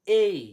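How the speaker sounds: "এই" loud and high, gliding e to i; A as a glide. Shown as a demonstration. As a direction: punch out "এই" as a long ay-ee. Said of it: "এটাকে কি পড়ব?". ("এই" জোরে, টেনে উচ্চারণ)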